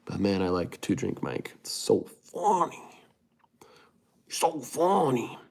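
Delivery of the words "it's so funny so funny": high-pitched, stretched 'so funny'; swooping up high on 'funny'; high drawn-out delight. Real, not acted. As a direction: sing it out high and long, savouring it. Drawn-out delight at a favourite comic.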